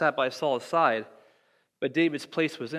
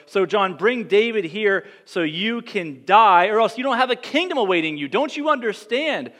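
louder and higher, a paraphrased angry king; angry Saul; 5 semitones higher, 5 dB louder than usual. Retold or imitated. Saul scolding his son Jonathan, angry and loud. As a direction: bark it at your son like a furious king. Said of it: "Saul goes on and he says".

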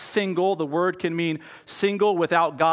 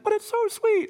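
squeaky high-pitched bee; a tiny bee pleading in a falsetto squeak. Retold or imitated. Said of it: "And the bee's like".

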